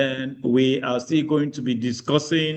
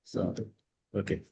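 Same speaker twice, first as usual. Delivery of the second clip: very faint, far from the mic; barely audible; 5 semitones lower, 12 dB quieter than usual. Real, not acted. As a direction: speak almost too quietly to hear. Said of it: "Your voice is very low".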